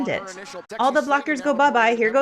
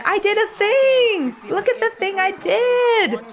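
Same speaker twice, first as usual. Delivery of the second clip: proud jammer voice; 12 semitones higher, 4 dB louder than usual. Retold or imitated. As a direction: show off like a delighted kid. Voicing a happy jammer.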